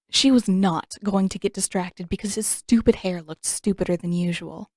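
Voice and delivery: ordinary delivery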